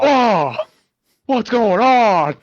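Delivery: loud, high, drawn-out impression; Andy Samberg's Nick Cage. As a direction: send up an over-the-top movie star, slowly. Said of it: "Andy Samberg's Nick Cage".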